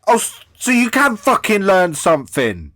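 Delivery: loud, high, scornful outburst; exasperated rant. Real, not acted. Mocking the guest's tech skills, loud scornful rant. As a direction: let the disbelief boil over. Ranting about guest learning streaming.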